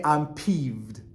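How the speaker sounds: understated, deadpan annoyance; straight-faced peeved. Shown as a demonstration. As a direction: say it keeping a straight face. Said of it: "Try to keep a straight face".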